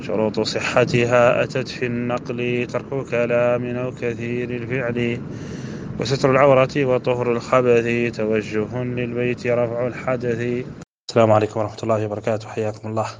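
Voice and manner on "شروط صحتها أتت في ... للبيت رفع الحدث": slow, drawn-out didactic verse; stately recited Arabic poem; verse recitation. Acted. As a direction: recite in measured metre, stretching the line endings. Recites the verse before teaching.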